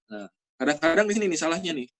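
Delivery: usual speaking voice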